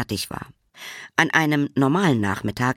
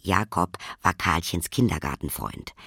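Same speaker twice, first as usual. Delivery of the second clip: usual speaking voice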